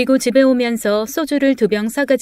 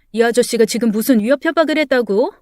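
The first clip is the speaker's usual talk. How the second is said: ordinary delivery, nothing marked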